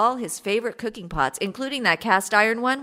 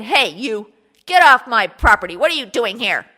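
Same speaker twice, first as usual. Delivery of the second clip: loud angry yell at the intruder; 3 semitones higher, 11 dB louder than usual. Acted. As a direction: shout him off your land. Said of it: "that made him mad".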